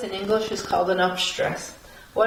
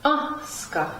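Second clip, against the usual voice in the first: "Aska" with the A raised in pitch, then falling; upstress on first syllable; 5 semitones higher, 3 dB quieter than usual. Shown as a demonstration. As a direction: lift the A of "Aska", drop "ska". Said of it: "right above the sound on".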